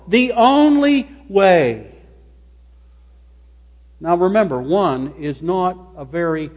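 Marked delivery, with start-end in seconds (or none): 0.1-1.9 ONLY stretched out slowly; stress on ONLY. Shown as a demonstration. Preacher driving home one way to God, stress on ONLY. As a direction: draw out "only", word by word.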